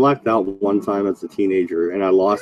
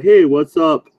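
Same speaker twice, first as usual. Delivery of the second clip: a louder, higher, upbeat hey; cheery greeting; 6 semitones higher, 5 dB louder than usual. Retold or imitated. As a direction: greet him like an old buddy. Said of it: "They're like".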